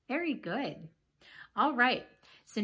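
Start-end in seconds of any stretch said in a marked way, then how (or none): 0.1-0.9 hushed praise; whispered. Real, not acted. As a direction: whisper it softly. Praise after quiz.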